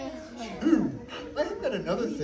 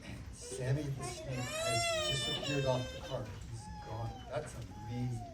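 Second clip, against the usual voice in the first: much higher, softer, hushed marvelling; mock amazement; 11 dB quieter than usual. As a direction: pretend to be astonished for the kids. Mock amazement at vanished snake.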